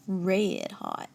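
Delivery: usual speaking voice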